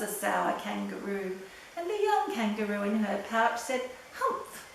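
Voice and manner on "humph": a high little scoffing humph. Acted. Baby kangaroo echoing its mother, a high squeaky humph. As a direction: copy mom's scoff, squeaky and small.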